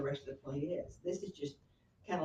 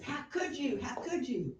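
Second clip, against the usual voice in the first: accusing Hamlet; 8 semitones higher, 5 dB louder than usual. Retold or imitated. Hamlet confronting mother.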